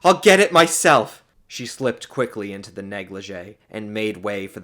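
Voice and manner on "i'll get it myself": high, loud defiance; forceful. A narrator's reading. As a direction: declare it, done with asking. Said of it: "she said forcefully".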